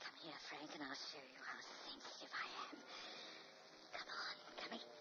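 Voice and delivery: low breathy whisper, luring him close; a woman's soft seductive whisper drawing a man in. Acted. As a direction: whisper it like a temptation.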